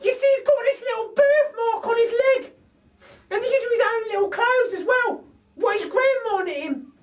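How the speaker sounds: a cheeky cockney burglar lady; Burglar Betty. A narrator's reading. Betty's held character voice.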